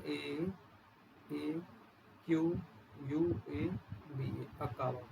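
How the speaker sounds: "A Q U A B A" spelled letter by letter, then "अकाबा"; spelling it out. Shown as a demonstration. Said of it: "इसकी spelling".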